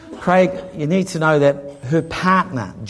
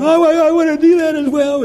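high stammering tearful voice; imitating a crying woman; 13 semitones higher, 4 dB louder than usual. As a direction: stammer it out through tears. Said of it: "Val's crying".

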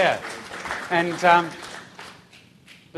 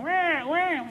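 imitated baby crying; 11 semitones higher than usual. Preacher showing the baby in bed with mum, wailing. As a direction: mimic a baby's wah-wah cries.

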